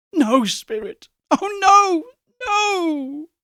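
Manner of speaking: high, sobbing cry of no; anguished crying. A narrator's reading. Scrooge sees his grave and cries out, sobbing. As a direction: break down begging.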